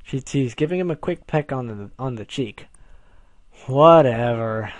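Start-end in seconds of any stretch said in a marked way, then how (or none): 3.7-4.7 long drawn-out, loud drone; droning. A narrator's reading. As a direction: drone it out slowly and dismissively. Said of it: "Jedediah droned".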